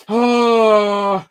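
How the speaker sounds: high, loud pained oh; dismayed oh. Retold or imitated. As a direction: let out a stricken high oh. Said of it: "I was like".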